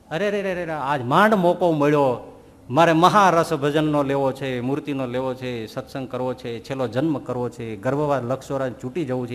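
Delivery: devotee's lament. Retold or imitated.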